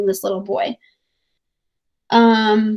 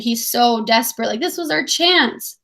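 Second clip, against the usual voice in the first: ordinary delivery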